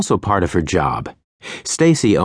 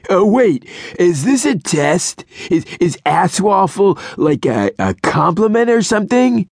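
much higher, eager; hopeful sleazy guy; 12 semitones higher than usual. A narrator's reading. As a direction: brighten up hopefully.

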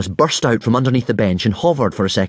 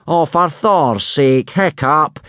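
slow, higher-pitched exasperated cry; exasperated yell; 6 semitones higher, 3 dB louder, slower than usual. A narrator's reading. Teacher despairs of his pupil fighting, yelling exasperated. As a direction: call out in drawn-out exasperation. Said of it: "yelled Gobber in exasperation".

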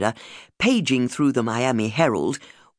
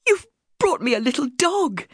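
high, thrilled exclamation; can't believe the gift; delighted disbelief; 13 semitones higher than usual. Retold or imitated. Thrilled wife receiving puppy.

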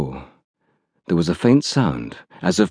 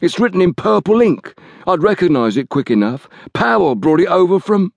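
the scared thug begging, pitched up; cornered man pleading, much higher voice; frightened Liggett; 11 semitones higher than usual. Acted.

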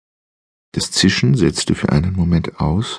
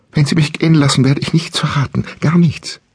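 much higher, louder frightened stammer; stammering in fear; 10 semitones higher, 3 dB louder than usual. Acted. Captive woman pleading with the voice, high frightened stammer. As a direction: stumble out the words in panic. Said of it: "stammelte sie".

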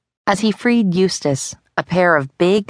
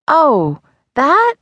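high rising, louder nervous "that"; boy hiding fear from papa; nervous fake casual; 11 semitones higher, 4 dB louder than usual. A narrator's reading. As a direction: try to sound casual, failing. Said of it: "trying his best to hide his fear".

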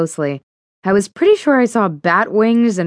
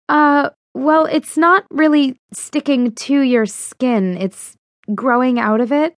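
hesitant; 7 semitones higher than usual. Acted. Fiona hesitant explaining.

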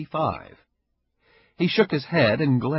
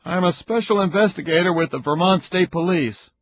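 louder, fast, blunt man's voice; stern investigator, irritated; 8 semitones higher, 6 dB louder than usual. Acted. Caperuzzi threatening Ida with a complaint, loud and fast. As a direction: push through it like an angry official.

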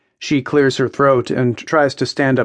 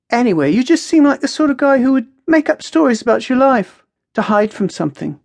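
Maya, musing; 12 semitones higher, 4 dB louder, faster than usual. A narrator's reading. Maya sizes Jack up on the balcony, musing. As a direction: muse aloud in Maya's high voice. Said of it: "says with a distant look in her eyes".

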